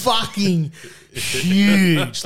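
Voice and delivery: very slow, drawn-out, high comic exaggeration; big storyteller's punchline; hammed-up emphasis. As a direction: stretch it out to show the scale. Hammed-up size of vomit.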